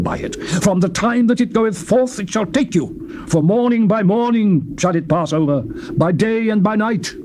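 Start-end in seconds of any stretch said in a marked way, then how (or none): none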